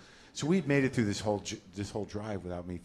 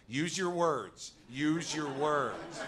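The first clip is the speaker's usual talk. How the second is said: slow, higher, patient therapist voice; earnest wife; 6 semitones higher, much slower than usual. Retold or imitated. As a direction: spell it out slowly like to a child. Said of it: "you know, earnestly".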